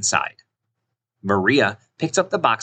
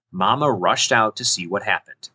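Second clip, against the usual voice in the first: usual speaking voice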